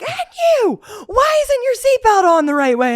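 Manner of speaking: high whiny cop scolding; high mock cop. Cop turns on me over seatbelt, high whine. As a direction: squeal the cop's nitpick. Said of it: "he was like".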